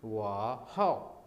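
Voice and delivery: वाह हाओ very slow; Chinese wo hao. Shown as a demonstration. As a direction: drag out वाह हाओ clearly. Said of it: "अगर मैं कहूँ कि I am good". (वाह हाओ बहुत धीरे-धीरे)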